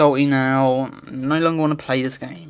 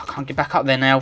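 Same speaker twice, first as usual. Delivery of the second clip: ordinary talk, nothing marked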